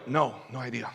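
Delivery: low murmured no idea; quiet shrug of a reply; hushed reply. Retold or imitated. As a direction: mutter it under your breath.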